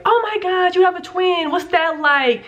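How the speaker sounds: high-pitched mock astonishment; imitated amazement. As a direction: gush like someone whose mind is blown. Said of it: "it still blows people's minds".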